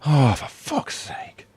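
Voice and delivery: breathy fed-up whisper under breath; exasperated whisper. Real, not acted. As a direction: mutter it, annoyed at the request. Exasperated at redoing the ending.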